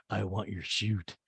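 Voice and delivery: low, soft, slow delivery; Lisa impression. Lisa answers Asher's compliment, low and understated. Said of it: "And Lisa goes".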